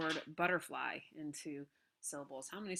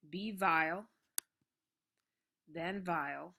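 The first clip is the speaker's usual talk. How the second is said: each "vile" punched after a lighter word; heartbeat beat; 7 dB louder, slower than usual. Shown as a demonstration. Teacher cutting a line into syllables, heartbeat rhythm. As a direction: pound out "vile" in two-beat pairs with pauses. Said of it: "give it a little heartbeat".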